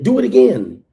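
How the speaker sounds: high, loud goading voice; mocking pushy taunt. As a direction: egg him on like a pushy hype man. Mimics pushy man pressuring him.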